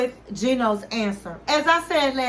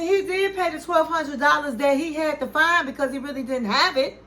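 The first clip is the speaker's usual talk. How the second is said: ordinary delivery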